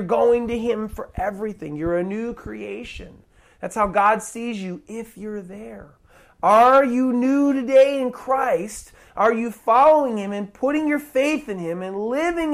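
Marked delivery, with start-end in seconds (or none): none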